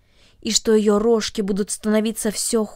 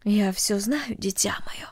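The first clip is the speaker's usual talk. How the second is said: quiet mother; 6 dB quieter, slower than usual. Acted. Mother soothing Cornelli, soft and hushed. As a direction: gently hush the frightened child. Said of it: "тихо сказала мать".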